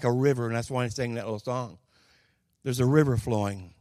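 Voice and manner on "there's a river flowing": usual speaking voice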